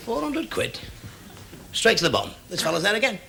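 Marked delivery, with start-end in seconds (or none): 0.1-0.8 incredulous. Acted. Diver aggrieved at seeing rival below.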